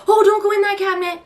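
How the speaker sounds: put-on high, quick alarmed call to the toddler; edgy warning. Mom calling out to her toddler near a cabinet, high and edgy. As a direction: snap out a nervous warning.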